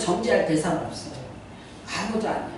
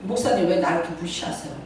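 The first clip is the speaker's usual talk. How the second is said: lower, fast mock complaint; imitating a hurt congregant; 6 semitones lower, 4 dB quieter, faster than usual. Member accuses the pastor, mock sulky and quick. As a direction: lightly voice the sulking member, quicker. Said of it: "갑자기 이러는 거야".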